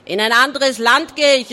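loud, defiant pirate reply; calling out. A narrator's reading. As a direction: fire the answer back loudly. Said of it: "rief Barty". (laut und trotzig gerufen)

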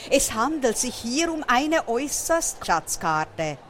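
ordinary delivery

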